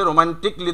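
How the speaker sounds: romantically said "ro-man-tik-li"; silent A. Shown as a demonstration. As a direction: drop the A in romantically, ending "-tikli". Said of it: "keep silent A".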